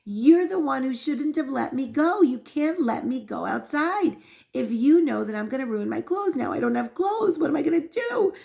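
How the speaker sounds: high-pitched, exasperated accusation; mock outraged wail; retold child's yelling. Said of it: "he keeps yelling at his father".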